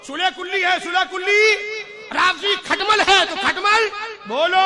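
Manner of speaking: loud, high insistent chant of names; fearless proclamation. Retold or imitated. Retelling the saint's fearless words, loud and insistent. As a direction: hammer each label home. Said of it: "डंके की चोट पे फरमाते थे".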